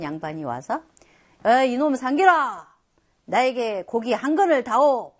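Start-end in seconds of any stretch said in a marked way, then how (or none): 1.5-5.1 loud, rude order from a haughty nobleman; a lord yelling a rude order at the butcher Sanggil. Retold or imitated. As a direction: bark it down at the butcher. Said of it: "막 이랬어요".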